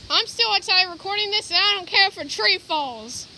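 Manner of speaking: loud, defiant shout over the roar; shouting over wind. Real, not acted. Recording outside in the storm, shouting defiantly over the wind. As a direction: raise the voice to be heard over the gale.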